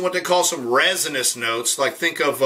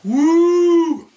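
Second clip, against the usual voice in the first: excited whoop; 11 semitones higher, 6 dB louder than usual. Real, not acted. Reacting to strong high-proof bourbon.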